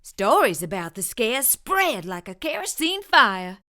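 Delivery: usual speaking voice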